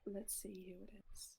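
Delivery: soft, secretive whisper; hushed aside. Acted. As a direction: whisper like sneaking a look. Peeking at new visitor.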